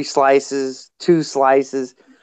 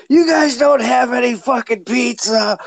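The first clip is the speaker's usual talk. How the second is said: higher, half-mock angry yell; imitated angry yell; 9 semitones higher than usual. Night Strike through the door, higher mock yell. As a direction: shout at the pranksters to stop. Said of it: "He's screaming inside that room".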